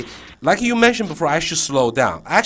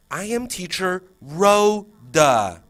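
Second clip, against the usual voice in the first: higher, very slow "Rho... da"; slow syllable-split introduction; 5 semitones higher, 5 dB louder, much slower than usual. Shown as a demonstration. Teacher teaching kids the name, slow and deliberate. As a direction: draw out "Rhoda" in two separated syllables. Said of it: "you know, two syllables".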